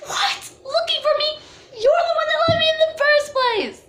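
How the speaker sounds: high, squealing, laughing outrage; outraged disbelief. Real, not acted.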